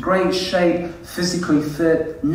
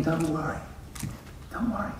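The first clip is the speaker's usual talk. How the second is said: very soft, soothing comfort; gentle reassurance; 12 dB quieter, faster than usual. Acted. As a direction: say it hushed, with a pause between. Repeating the comforting words to vulnerable.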